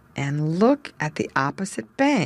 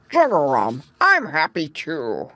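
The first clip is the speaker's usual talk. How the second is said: put-on gruff old frog. A narrator's reading. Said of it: "said Grandfather Frog".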